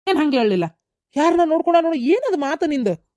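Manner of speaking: mock her denial in anger; angry retort. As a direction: son throws his mother's denial back at her, angry. Son confronting mother's denial.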